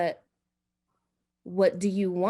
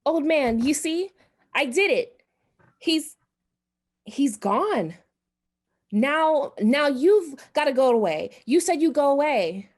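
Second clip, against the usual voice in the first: higher and louder, breathless urgency; frantic high demand; 6 semitones higher, 4 dB louder than usual. Acted. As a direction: press the old man desperately. Wife demands the ghost leave.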